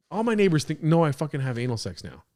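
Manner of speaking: ordinary talk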